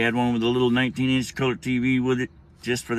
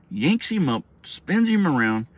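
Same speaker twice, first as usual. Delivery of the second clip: usual speaking voice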